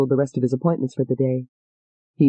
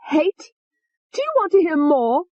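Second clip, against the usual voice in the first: high, venomous female alter; high hateful young woman; vicious Tony; 12 semitones higher than usual. Acted. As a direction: sneer the threat up high. Tony's voice.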